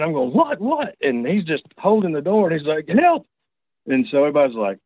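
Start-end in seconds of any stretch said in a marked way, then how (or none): 0.3-1.0 high, startled retold "what"; acted-out alarm, pitched way up; alarmed questioning. Alarmed questions behind slammed door. 2.9-3.3 high, urgent retold yell; acted-out panicked holler; hollering for help. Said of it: "Moose is hollering for help".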